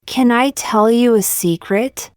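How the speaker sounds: ordinary talk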